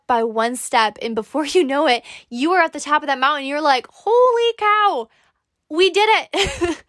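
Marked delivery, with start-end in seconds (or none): 3.9-6.8 high, loud and slow cry of triumph; excited cheer. Pretend. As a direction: shout it from the mountaintop. Imagined summit triumph.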